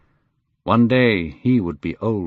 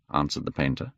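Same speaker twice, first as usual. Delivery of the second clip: plain reading voice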